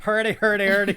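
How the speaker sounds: loud, high parody of bad Irish accent; mock Irish accent. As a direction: mimic a silly Irish accent loudly. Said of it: "Let's hear your Irish accent".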